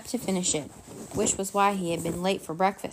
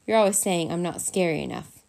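ordinary delivery, nothing marked

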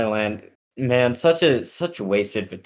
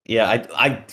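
ordinary talk, nothing marked

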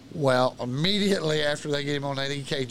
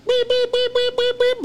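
very high, loud repeated beeps; piercing high electronic beeping; imitated alarm beeps; 7 dB louder than usual. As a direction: mimic a hospital alarm going off.

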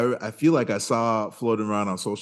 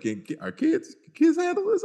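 incredulous. Retold or imitated. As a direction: voice shooting up in disbelief. Imagined worried question about kids.

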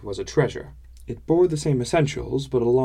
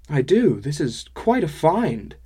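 impressed; 5 semitones higher, 5 dB louder, slower than usual. Acted. Narrator admires painting.

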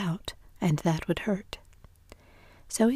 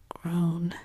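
ordinary delivery, nothing marked

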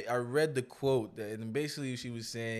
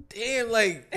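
laughing; 8 semitones higher, 8 dB louder than usual. Real, not acted. Laughing at Jada news.

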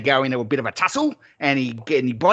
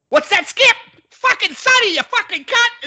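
much higher pretend yell at the kangaroo; mock yelling; 6 dB louder than usual. Imagines yelling at a kangaroo for the camera, pretend yell. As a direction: yell it like a bloke in a fight on camera. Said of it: "I'm gonna be yelling something funny".

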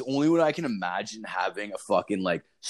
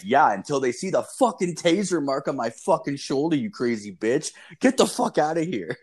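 heated, higher-pitched telling-off; worked-up comeback in a raised pitch; retold angry retort; 5 semitones higher than usual. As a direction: build to an indignant order to get out. Retold angry order to leave.

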